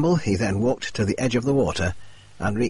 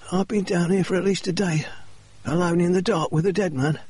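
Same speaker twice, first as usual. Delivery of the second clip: higher, strained voice for Murray; injured man's voice; 8 semitones higher than usual. Acted. Murray recounting his ordeal to the doctor, higher pitched voice. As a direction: voice Murray's ordeal high and tense. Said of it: "Murray began".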